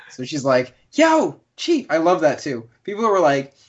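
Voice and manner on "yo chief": high-pitched call, imitating a game villager; playful high holler; mock villager yell. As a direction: call out high to get attention. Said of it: "villagers yell at you".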